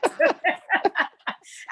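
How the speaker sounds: bright laugh breaking out; high burst of laughter. Real, not acted. Laughing at her own story.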